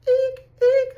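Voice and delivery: very high, loud eek; playful high-pitched shriek; mock frightened squeal. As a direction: squeal in pretend alarm. Mock fright at possessive man.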